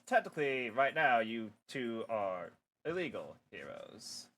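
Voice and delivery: normal talking voice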